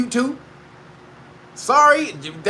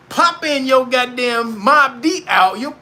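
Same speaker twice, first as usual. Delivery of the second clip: ordinary talk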